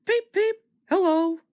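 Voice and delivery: high, light little engine voice; a high toy engine greeting; Edward's peep. A narrator's reading. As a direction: chirp it like a small cheerful engine. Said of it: "Edward would say".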